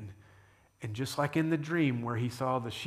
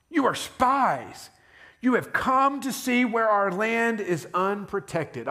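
slow, high, louder accusation; harsh accusation; 7 semitones higher, 4 dB louder, slower than usual. A narrator's reading. Joseph accusing his brothers of spying, harsh and slow. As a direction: lay the charge on them slowly, hard. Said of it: "he spoke harshly to them".

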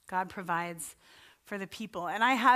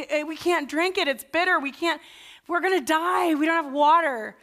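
higher, louder desperate whine; panicked complaint; 6 semitones higher, 5 dB louder than usual. Retold or imitated. Thirsty Israelites protesting to Moses, panicky raised voice. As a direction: sound frantic about the bitter water.

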